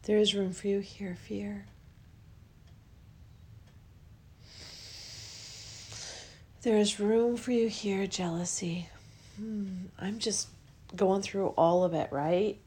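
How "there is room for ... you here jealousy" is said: guided affirmation. Acted. Host guiding listeners to welcome each feeling, soft affirmation. Leads a guided emotional release.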